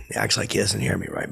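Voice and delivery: normal talking voice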